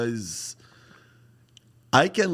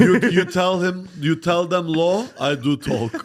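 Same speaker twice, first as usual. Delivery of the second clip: high-pitched broken-English bit; 8 semitones higher, 4 dB louder than usual. Acted. Comic playing a foreigner giving orders, broken English. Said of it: "when I break my English".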